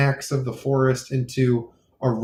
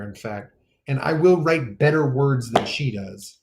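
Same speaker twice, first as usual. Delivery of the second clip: ordinary talk, nothing marked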